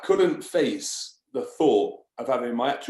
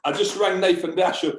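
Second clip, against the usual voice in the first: ordinary talk, nothing marked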